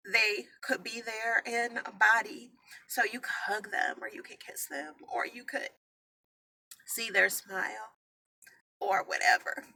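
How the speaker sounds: barely holding back tears; trailing off into tearful quiet; tearful hush. Real, not acted. Grieving, fading to a hush.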